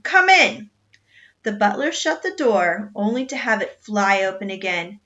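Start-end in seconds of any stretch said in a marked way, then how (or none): 0.0-0.7 booming high captain's shout; cannon roar. A narrator's reading. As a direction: bark the order over the wind. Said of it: "in a voice like the roar of a cannon".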